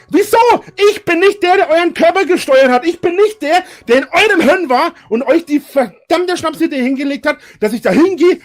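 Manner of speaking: normal talking voice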